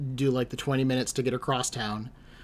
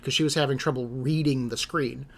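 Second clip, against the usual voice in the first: ordinary delivery, nothing marked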